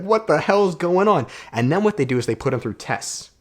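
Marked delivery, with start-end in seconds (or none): 0.0-1.3 mock freaked-out boy. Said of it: "the little boy, is freaking out".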